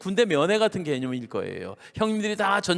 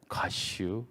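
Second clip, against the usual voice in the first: low, hushed Chungcheong one-worder; Chungcheong dialect; 8 semitones lower, 9 dB quieter, much slower than usual. Shown as a demonstration. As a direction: drop a quiet Chungcheong punchline. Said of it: "충청도는 뭐라고 하냐면". (낮고 조용한 충청도 사투리로)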